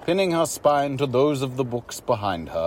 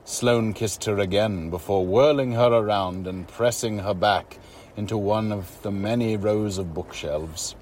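plain reading voice

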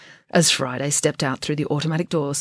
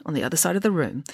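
ordinary delivery

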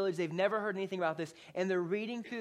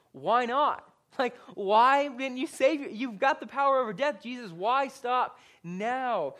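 loud, higher-pitched, baffled plea; urgent questioning; 5 semitones higher, 7 dB louder than usual. Acted. Preacher wrestles with why Jesus stayed, urgently baffled. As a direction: demand an answer, pressing hard. Voices bewildered challenge to Jesus.

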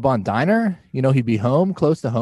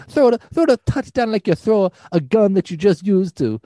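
put-on Italian mobster accent; 8 semitones higher than usual. Italian mafia voice continues.

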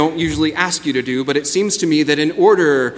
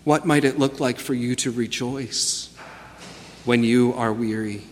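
guided meditation; 2 semitones lower, 7 dB quieter than usual. Acted. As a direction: guide them gently into stillness. Leads a guided reflection.

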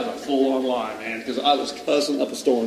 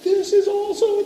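high-pitched imitated boyish wonder; awestruck kid. Boy finds a mother load of gifts, awestruck. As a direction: marvel in a much higher voice.

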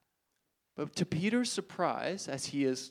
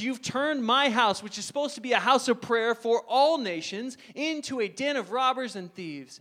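forceful rebuke; 6 semitones higher, 4 dB louder than usual. Acted. Jesus rebuking temple sellers.

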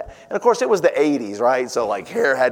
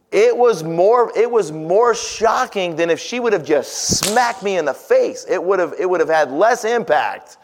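much higher and louder, building emphasis; rising outrage; 7 semitones higher, 4 dB louder than usual. Real, not acted. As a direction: preach it with climbing disbelief. Preacher builds to shocked peak.

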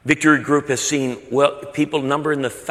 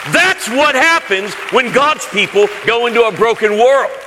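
preaching at full force; 10 semitones higher, 8 dB louder than usual. Real, not acted. Preacher's climax after the story.

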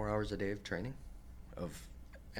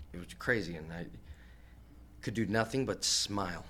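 ordinary talk

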